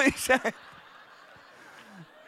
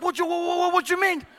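baffled midwife; 6 semitones higher, 4 dB louder, faster than usual. Retold or imitated. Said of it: "the midwife's like".

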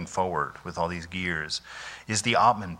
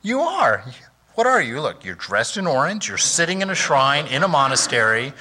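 high, loud self-scolding, half laughing; scolding inner voice; 5 semitones higher, 4 dB louder than usual. Retold or imitated. Monk recalling his inner voice in the shrine, loud exasperated scolding. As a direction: snap at yourself in disbelief. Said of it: "I thought".

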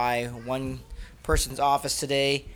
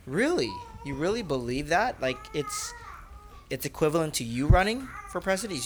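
raised voice, incredulous; mock surprise; 4 semitones higher than usual. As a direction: act amazed to tease him. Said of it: "And I said".

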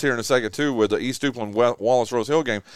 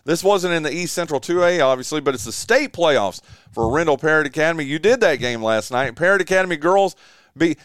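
ordinary talk

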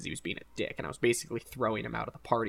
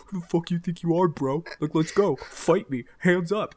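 higher and a bit louder, squaring up to a guy twice his height; mock tough challenge; 5 semitones higher, 4 dB louder than usual. Short guy challenging a tall partygoer, cocky mock tough voice. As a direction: pick a fight you can't win, all bravado.

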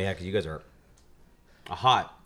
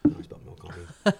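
breathy giggling whisper; laughing whisper; 7 semitones higher than usual. Real, not acted. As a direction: laugh it out under your breath. Laughing whisper after spilling milk.

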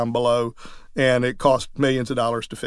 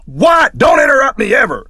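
high, loud furious shout; a cranky old judge yelling at the deputy; angry judge; 10 semitones higher, 6 dB louder than usual. Retold or imitated. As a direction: bark it like an outraged old judge. Deaf judge snaps at deputy.

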